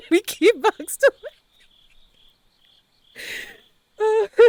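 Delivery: loud and high, breaking into laughter; laughing self-mockery. Real, not acted. Streamer joking to newcomers about herself, laughing. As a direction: announce it while cracking up.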